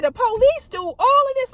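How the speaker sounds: wide-eyed disbelief; amazed exclaim. Retold or imitated. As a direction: marvel at it, voice jumping up. Amazed at police work.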